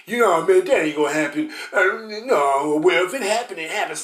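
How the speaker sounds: loud, careless brush-off in a mock voice; mocking scoffers. Preacher mocking listeners who dismissed warnings, loud and careless. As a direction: ham up the people who laughed it off.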